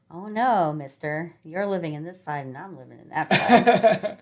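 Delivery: laying down the law to her husband, half laughing; mock stern wife. Retelling how she told her husband no, loud and laughing. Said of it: "And I'm like".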